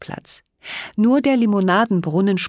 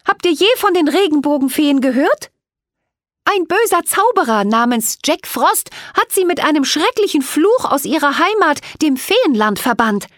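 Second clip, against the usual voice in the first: put-on higher, louder earnest girl voice; girl Cathy; 10 semitones higher, 5 dB louder than usual. A narrator's reading. Cathy tells the angry crowd about the rainbow fairies. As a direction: step forward and explain to the crowd.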